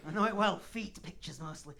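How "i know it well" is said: loud, knowing mock heckler; hammed-up loud boast, imitating an audience member; one in the crowd. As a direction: call it out like a seasoned regular. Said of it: "There's always one in the crowd, like".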